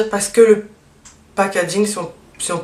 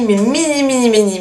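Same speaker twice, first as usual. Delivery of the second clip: mini said higher and louder, stretched for emphasis; gushing repeated mini; emphatic mini; 4 semitones higher, 4 dB louder than usual. Real, not acted. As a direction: insist on how tiny it is, pitch up on each mini. Exaggerating how tiny it is.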